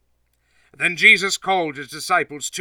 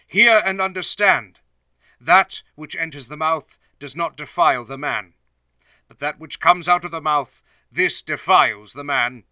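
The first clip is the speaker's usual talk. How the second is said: louder, raised proclaiming voice; calling out; 2 semitones higher, 5 dB louder than usual. Acted. Jesus calls out loudly to the gathered multitude. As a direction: address the whole crowd at once.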